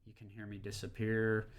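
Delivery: gain turned down. Shown as a demonstration. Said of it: "if I was to turn the gain down".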